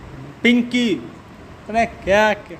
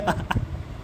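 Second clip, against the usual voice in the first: breathy laugh; 9 dB quieter than usual. Real, not acted. Laughing at own joke.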